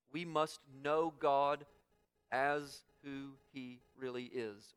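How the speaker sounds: usual speaking voice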